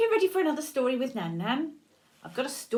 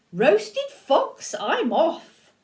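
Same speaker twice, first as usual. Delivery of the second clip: higher and louder, a hammed-up yelp of alarm; startled fox; 6 semitones higher, 7 dB louder, much slower than usual. Fox suddenly frightened and hurrying away, mock alarm. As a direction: squeal and bolt.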